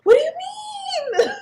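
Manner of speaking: high, loud cry of disbelief; shocked disbelief. Retold or imitated. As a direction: squeal it in sheer shock. Retold shocked reply to nurse.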